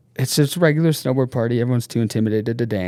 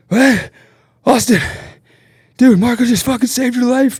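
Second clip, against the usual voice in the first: high, loud disbelief; much higher, louder stunned outburst; shocked; 14 semitones higher, 7 dB louder than usual. Retold or imitated. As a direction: blurt it out stunned. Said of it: "I'm just sitting there just like".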